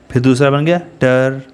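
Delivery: usual speaking voice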